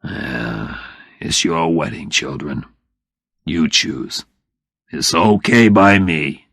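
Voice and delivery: father hiding his hurt; brave but let down. Acted. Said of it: "But he spoke bravely".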